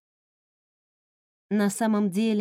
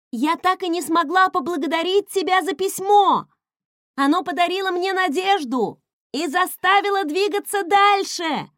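high, loud cry against the wind; shouting over the wind; 8 semitones higher, 5 dB louder than usual. A narrator's reading. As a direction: yell so she hears over rushing air. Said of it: "прокричала я, перекрикивая ветер". (кричит, перекрикивая ветер)

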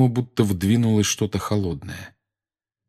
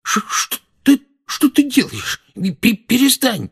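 high, stammering panic; terrified; 3 dB louder than usual. Acted. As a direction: stutter it in terror. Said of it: "насмерть перепуганного Дадли".

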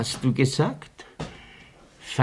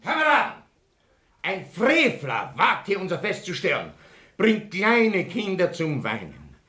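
pompous speech; 7 semitones higher than usual. Acted.

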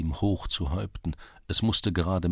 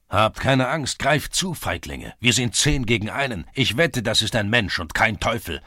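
loud, raised rallying shout; urging his men on; 4 semitones higher, 6 dB louder than usual. A narrator's reading. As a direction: spur the companions on loudly. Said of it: "feuerte der Schulze seine Begleiter an". (lautstark anfeuern)

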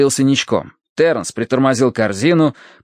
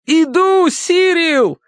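very high, urgent call; 3 dB louder, much slower than usual. A narrator's reading. Terence calls to his drowning dog, urgent shout. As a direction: call across the water to rescue.

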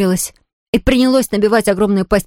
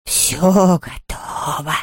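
very slow, hushed ghost voice; rustling ghost; 3 semitones lower, 3 dB quieter, much slower than usual. A narrator's reading. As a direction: draw the words out in a soft rustle. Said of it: "прошелестел Хуга". (протяжно шелестя, как привидение)

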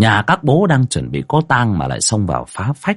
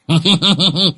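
high, tittering hee-hee; giggling chuckle; 10 semitones higher than usual. A narrator's reading. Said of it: "cười hì hì".

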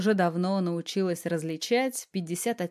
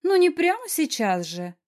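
high, louder complaint; grumbled protest; 10 semitones higher, 5 dB louder than usual. A narrator's reading. Wife protests to eager husband, grumbling. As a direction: object in a raised, high voice. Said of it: "заворчала".

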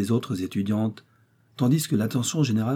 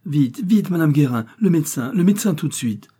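high, louder cry for help; urgent call; 6 semitones higher, 5 dB louder than usual. A narrator's reading. Daughter sending the concierge for the doctor, urgently calling.

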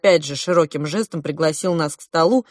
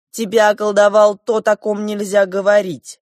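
firm, raised-voice pronouncement; 4 semitones higher, 7 dB louder than usual. A narrator's reading. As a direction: state it louder, held high and even. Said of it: "твёрдо сказал младший принц".

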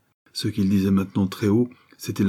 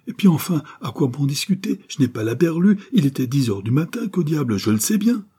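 high-pitched, heated insistence; exasperated protest; 7 semitones higher than usual. A narrator's reading. Accused insists to the judge, exasperated and high. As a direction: pitch it up, fed up and stubborn.

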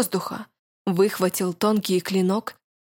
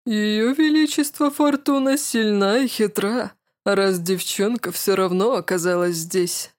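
caustic mocking. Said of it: "Едко посмеялся мужчина".

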